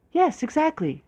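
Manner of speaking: high, delighted yes; excited exclaim. A narrator's reading. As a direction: gush with delight. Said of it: "she exclaimed".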